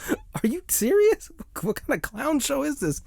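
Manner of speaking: very high-pitched, stretched disbelief; incredulous. Retold or imitated. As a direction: squeal in baffled outrage at a ridiculous movie moment. Mocking the monkey appointment.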